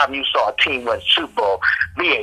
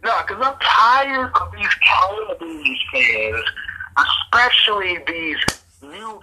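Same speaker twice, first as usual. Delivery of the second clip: slow, higher-pitched exasperation; steamed up; 7 semitones higher, slower than usual. Real, not acted. Ranting about new Cowboys fans, slowly and steamed up. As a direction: drag it out, fed up. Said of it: "seen you steamed up like this".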